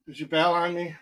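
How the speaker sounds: ordinary talk, nothing marked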